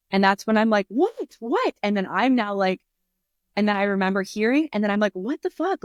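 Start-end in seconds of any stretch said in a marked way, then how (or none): 0.9-1.7 sharply rising, much higher startled "What? What?"; jolted awake, high and baffled; confused what. Retold or imitated. Waking on the kitchen floor to Mitch panicking, startled and high. 5.1-5.8 startled what the fuck. Retold or imitated. Waking up hearing Mitch panic.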